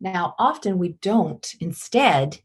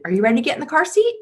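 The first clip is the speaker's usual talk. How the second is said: much higher, fast, rising question; sweet asking mom; 8 semitones higher, much faster than usual. Shown as a demonstration. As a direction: rush it up high as a sweet question. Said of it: "It's very different than".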